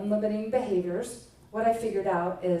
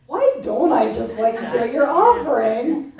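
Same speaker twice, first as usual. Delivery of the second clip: laughing; 7 dB louder than usual. Retold or imitated. Retold reply to husband.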